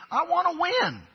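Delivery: high-pitched objector's protest; mock protest. Arguing spouse refusing to be gracious, high mock protest. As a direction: jump up high in mock insistence.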